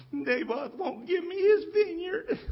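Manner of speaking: very high, slow, crying whine; a spoiled king blubbering in bed; sulky child wailing to mommy; whiny crybaby. Retold or imitated. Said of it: "having a pity party".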